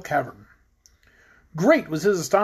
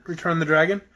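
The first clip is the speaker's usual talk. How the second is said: ordinary delivery, nothing marked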